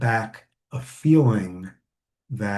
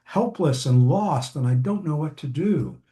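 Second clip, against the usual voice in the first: helpless parent; 3 semitones higher than usual. Retold or imitated. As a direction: beg for help like a parent at a loss. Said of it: "They'll, like, say".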